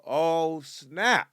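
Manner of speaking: normal talking voice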